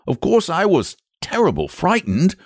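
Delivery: high-pitched frightened rustic; anxious shepherd voice; troubled shepherd. Acted. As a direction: confess the fright as a country shepherd.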